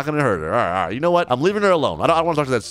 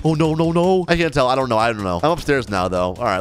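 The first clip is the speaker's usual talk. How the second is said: ordinary talk, nothing marked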